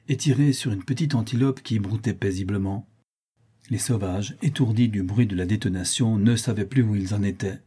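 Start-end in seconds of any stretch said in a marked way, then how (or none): none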